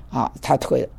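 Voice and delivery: ordinary delivery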